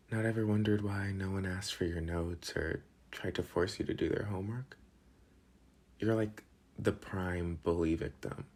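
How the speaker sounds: usual speaking voice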